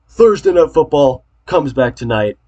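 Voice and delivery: excited. Real, not acted. Hyping football's return.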